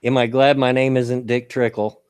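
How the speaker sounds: ordinary delivery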